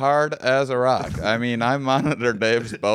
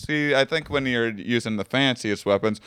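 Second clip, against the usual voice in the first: ordinary talk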